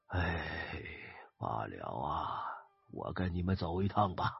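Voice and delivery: put-on low tired voice of a very old man; aged old man. A narrator's reading. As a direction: sigh it out like a ninety-year-old accepting death. Old man of ninety resigns himself.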